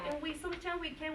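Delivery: ordinary talk, nothing marked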